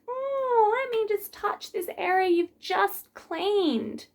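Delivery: high, breathy fake-sweet voice; mock customer. Piercer imitating a customer touching the cleaned area, high and sweet. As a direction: squeak it like a clueless customer.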